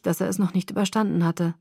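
ordinary delivery, nothing marked

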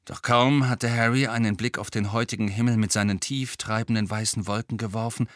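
usual speaking voice

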